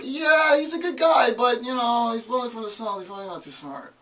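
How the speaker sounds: mock condescension. Lecturer voicing people who judge Southern speakers, mock condescending. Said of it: "you say it with an accent".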